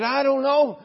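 put-on high, loud voice of a child; little boy's protest. Boy who ran to his mother, high-pitched protest. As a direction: blurt it out like a worked-up boy.

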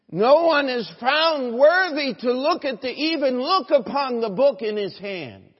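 loud, high-pitched cry of despair; weeping lament. Acted. John loses it at the throne, acted weeping lament. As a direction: wail it out, acting overcome. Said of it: "He's weeping".

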